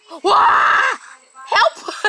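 high, loud panicked yelling for help. Real, not acted. Gamer fleeing the honey badger, panicked high yell. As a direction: shriek for help as it catches you.

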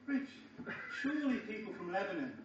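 normal talking voice

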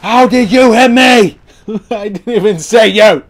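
very loud, high indignant yell; outraged shout. Real, not acted. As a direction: yell in disbelief at a cheap hit. Hit by unseen enemy.

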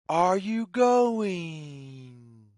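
ordinary delivery

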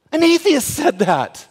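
preaching at full force to the congregation. Real, not acted. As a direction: let it ring out from the pulpit. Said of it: "I'm preaching".

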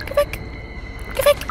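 terrified pleading. Real, not acted. Scared gamer begging the teddy to help, desperate plea.

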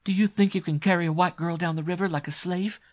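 high, loud, outraged challenge; shrieked indignation. Acted. Struggling girl defying her abductor, shrieking. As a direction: protest hotly, pitched right up. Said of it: "shrieked Constance".

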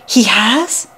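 breathless whispered question; shocked whisper. A narrator's reading. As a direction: gasp the words out in a whisper. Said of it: "Hart gasped".

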